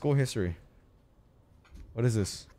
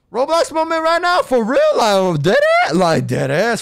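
louder, higher mocking call-out; hype yelling; 3 semitones higher, 8 dB louder than usual. Real, not acted. Player loudly mocks the new Roblox game to his teammate. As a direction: yell it for the chat.

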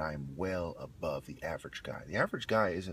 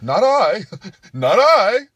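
very high, loud mock refusal; reluctant shorter guy; 19 dB louder, slower than usual. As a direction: yelp your refusal, playing it for laughs. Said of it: "this shorter guy".

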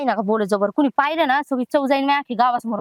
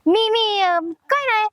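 flustered; 7 semitones higher, much slower than usual. Acted. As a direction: caught out, high-pitched and hesitant. Caught by father-in-law, stammering excuse.

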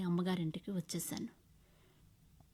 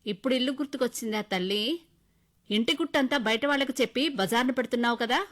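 louder, sneering scold; angry scolding; 5 semitones higher, 7 dB louder than usual. Acted. Mother-in-law scolding daughter-in-law, loud angry scolding. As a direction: tear into the late daughter-in-law. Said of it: "అత్తగారు కోపంతో ... అరిచారు".

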